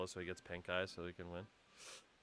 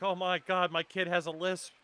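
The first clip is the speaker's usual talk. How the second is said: worried mom voice; 9 semitones higher, 7 dB louder, slower than usual. Retold or imitated. Voicing a worried parent.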